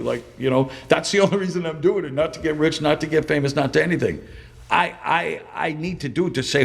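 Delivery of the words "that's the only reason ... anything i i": ordinary delivery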